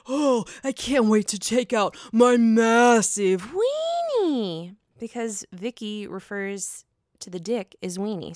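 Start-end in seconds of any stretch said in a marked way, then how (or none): 0.1-4.8 slow, put-on dopey male voice; ex-boyfriend bragging, slow mock voice; mocking Steve's voice. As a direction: drag it out like a smug boyfriend. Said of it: "this is how he says it".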